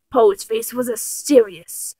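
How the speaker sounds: ordinary delivery, nothing marked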